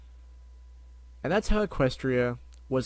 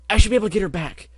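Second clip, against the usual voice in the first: ordinary delivery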